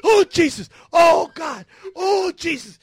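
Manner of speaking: high, loud pleading wails; panicked screaming. Retold or imitated. Man running round his room in an earthquake, screaming. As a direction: cry out to Jesus in hammed-up terror. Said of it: "I'm screaming".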